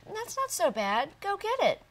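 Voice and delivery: cheerful little pig. Acted. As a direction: brush it off and urge him on. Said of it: "Otis said".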